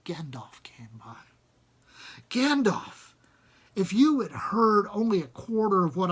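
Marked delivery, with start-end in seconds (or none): 0.1-1.2 whispered, low announcement; hushed reveal. A narrator's reading. Storyteller whispers Gandalf's arrival to the listeners. 2.3-3.1 high, loud cry of the name; excited exclamation. A narrator's reading. Narrator exclaims the wizard's name in wonder.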